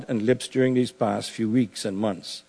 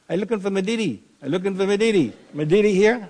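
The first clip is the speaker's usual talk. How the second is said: put-on much higher, playful Bahamian boy's voice; teasing a friend on the phone in Bahamian dialect; Bahamian island accent; 8 semitones higher, faster than usual. Pastor greeting Frank on the phone with the old joke, Bahamian accent. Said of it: "that accent over there".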